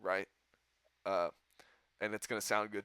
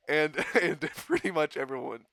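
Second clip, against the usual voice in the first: usual speaking voice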